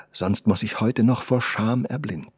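ordinary delivery, nothing marked

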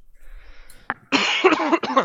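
harsh coughing fit, loud. Real, not acted. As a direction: cough hard and long. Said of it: "Sorry, I'm coughing now".

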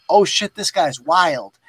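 ordinary delivery

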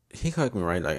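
ordinary delivery

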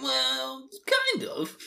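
high, hesitant put-on voice for Hurin; sheepish reply. Hurin admitting to Rand he still smells them, sheepish. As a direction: shrug it off with an awkward squeak. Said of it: "And he's like".

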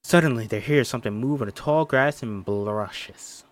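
ordinary talk, nothing marked

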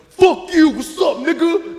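hammed-up street taunt; mock shout.

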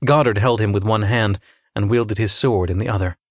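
plain reading voice

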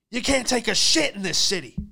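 high, loud ranting cabbie voice; driver ranting. Retold or imitated. Drunk driver complains to his passenger, loud rant. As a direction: rant it back over your shoulder.

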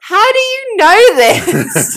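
slow, high, laughing disbelief; amazed laughing question. Real, not acted.

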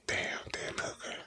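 very soft, voiceless murmur; disappointed whisper. Real, not acted. Podcaster let down by Dan Hooker, disappointed whisper. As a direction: whisper it under a spent breath. Disappointed aside about the fighter.

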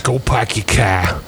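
slow, drawn-out Boston imitation; mock Boston accent. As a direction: drop the r's like a fake Bostonian. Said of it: "I'm talking like I'm from Boston".

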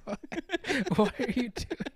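high, helpless laughing question; words giving way to giggles. Real, not acted. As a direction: ask it while dissolving into laughter.

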